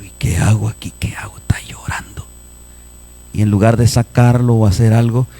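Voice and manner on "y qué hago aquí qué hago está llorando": hushed aside to oneself; acted secret muttering; whispered worry. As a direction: whisper it like a father at a loss. Father's anxious thoughts, whispered in church.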